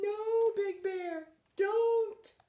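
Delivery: high, slow squeaky plea; Bunny pleading. Pretend. Bunny covering its eyes, begging Big Bear not to dive. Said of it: "Bunny saying".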